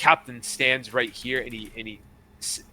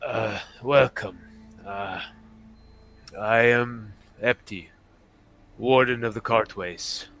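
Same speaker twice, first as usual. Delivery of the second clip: measured captain voice, slightly lowered; retired pirate captain; 3 semitones lower than usual. Acted.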